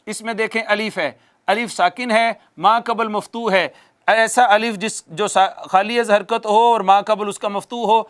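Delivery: ordinary delivery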